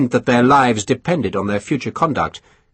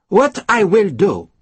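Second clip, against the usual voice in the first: higher, slow and final; no discussion; 8 semitones higher, 3 dB louder, slower than usual. A narrator's reading. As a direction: lay it down as settled. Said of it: "a voice which suggested that there could be no discussion".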